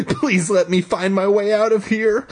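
high pleading desperate plea; high frightened pleading; 1 desperate prayer. Acted. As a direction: beg to get out of the dark. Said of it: "I prayed".

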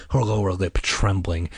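ordinary delivery

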